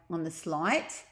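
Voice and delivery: usual speaking voice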